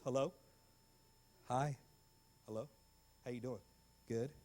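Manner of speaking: low, soft, halting small talk; mock a stilted call with long pauses; awkward phone chat. Teens with nothing to say on the phone, awkward. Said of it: "even if the conversation went like this".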